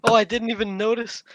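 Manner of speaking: high, loud laugh through words. Real, not acted. Amused realization.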